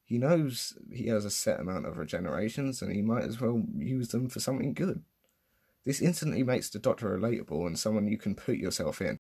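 normal talking voice

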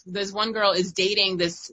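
ordinary talk, nothing marked

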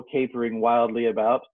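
plain reading voice

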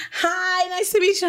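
high-pitched mock-friendly greeting; imitated friendly stranger. Imitating a new friend picked up on a trip, high chirpy greeting. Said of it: "they be like".

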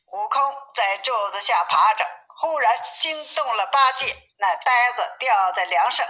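ordinary delivery, nothing marked

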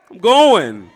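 loud, slow I'm going; loud declaration. Shown as a demonstration. Congregation told to declare it to their neighbor, loudly. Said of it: "look at your neighbor and say".

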